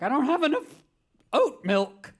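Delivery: high, loud comic panic; hammed-up panicky outburst; mock freak-out. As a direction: wail over a tiny crisis. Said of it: "little things freak me out".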